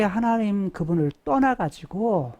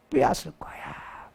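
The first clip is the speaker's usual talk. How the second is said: whispered threat; 2 semitones lower, 8 dB quieter than usual. Acted.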